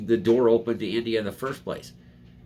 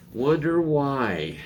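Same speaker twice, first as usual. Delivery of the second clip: higher, slow drawn-out wonder why; sarcastic wonder why; 5 semitones higher, much slower than usual. Shown as a demonstration. Vlogger mocking climate claims, slow sarcastic wonder why.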